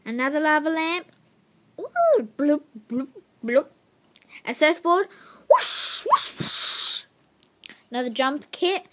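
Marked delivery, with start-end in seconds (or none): 1.8-3.7 louder playful bloops like bubbles rising; bubbly bloop sounds. Pretend. Kid showing a lava lamp, playful bubbly bloops. 5.5-7.1 loud breathy whoosh like surf; whooshing wave noise. Pretend. Kid showing a surfboard, loud breathy whooshing.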